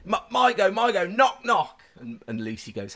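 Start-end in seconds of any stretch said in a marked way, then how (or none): 0.0-1.8 put-on high, loud child's voice; imitated eager son. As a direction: play a kid clamouring for a turn. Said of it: "He goes".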